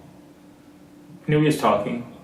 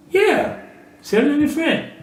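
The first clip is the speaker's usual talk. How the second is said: much higher, coaxing friend voice; eager scorpio; 13 semitones higher, slower than usual. Acted. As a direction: sell the friendship, pitched way up. Scorpio reassures frog.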